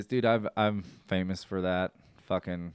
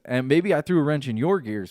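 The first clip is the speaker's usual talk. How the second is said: ordinary talk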